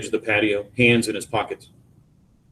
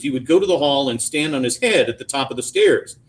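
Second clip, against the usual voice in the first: usual speaking voice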